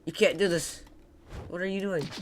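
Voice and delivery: ordinary talk, nothing marked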